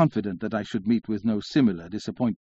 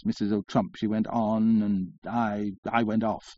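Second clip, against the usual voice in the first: ordinary delivery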